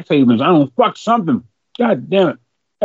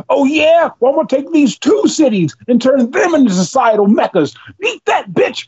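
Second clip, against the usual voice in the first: higher-pitched, one-upping billionaire rival; mock boastful taunt, pitched up; Jeff Bezos; 8 semitones higher than usual. Billionaire topping a rival's city makeover, mock cocky retort. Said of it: "And Jeff Bezos goes".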